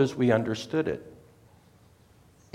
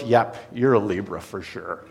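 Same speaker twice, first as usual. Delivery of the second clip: lower, knowing verdict; smug astrologer; 6 semitones lower than usual. Retold or imitated. Astrologer confirming the waiter's sign, lower and knowing. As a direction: settle the matter like a smug seer. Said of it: "And she goes".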